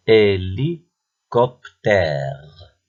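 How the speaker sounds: "Hélicoptère" slowly, hé-li-cop-tère; very slow, segmented "Hélicoptère"; split into syllables. Shown as a demonstration. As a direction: dictate "Hélicoptère" with a long final tère. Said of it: "repère le son qui se répète".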